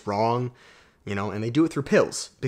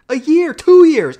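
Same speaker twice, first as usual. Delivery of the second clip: emphatic; 12 dB louder than usual. Real, not acted. Stressing a long period.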